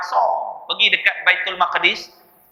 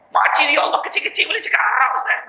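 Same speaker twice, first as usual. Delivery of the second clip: much higher, fast, breathy gush; mock auntie voice; 8 semitones higher, faster than usual. Ustaz mimics the auntie's awe, in a high fast squeal. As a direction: parody the auntie's amazement. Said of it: "Saya kata".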